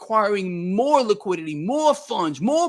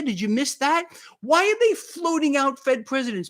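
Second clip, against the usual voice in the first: ordinary talk, nothing marked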